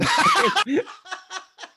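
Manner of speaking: high loud burst of laughter; high cackling laugh. Real, not acted. Laughing hard at the cop reading his name off the road, loud high cackle. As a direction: crack up loudly.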